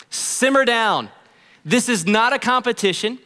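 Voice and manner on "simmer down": simmer down. Real, not acted. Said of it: "don't do it".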